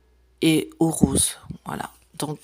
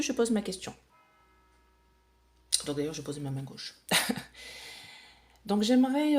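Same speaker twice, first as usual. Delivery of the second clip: ordinary talk, nothing marked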